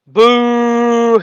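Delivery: high, drawn-out mock boo; booing crowd. Spectators booing the sniper, high mock jeer. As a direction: jeer at the shooter. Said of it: "the rest of them are like".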